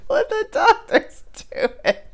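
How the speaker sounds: high, squeaky words dissolving into laughter; high-pitched, helpless amusement; laughing. Real, not acted. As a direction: crack up as you finish the sentence. Laughing at the film.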